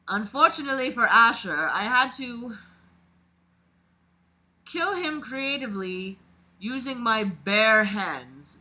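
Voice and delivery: dramatic read-aloud of a creepy story. A narrator's reading. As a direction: narrate it like a confession being read out. Reads the killer's story aloud.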